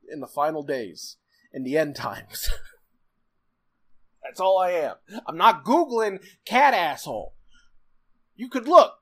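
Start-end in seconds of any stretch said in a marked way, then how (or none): 5.3-8.9 raised, higher irritated outburst; getting angry. Real, not acted. Refusing to look it up for co-host, getting angry. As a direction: get worked up, louder and higher. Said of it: "you're getting real angry".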